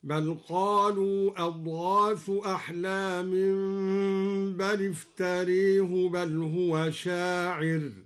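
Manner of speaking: افتراه with its final alif leaned toward e, slow chant; imala on iftarah. Shown as a demonstration. Reciter demonstrating imala, slow chanted افتراه. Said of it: "وأمال قوله تعالى افتراه".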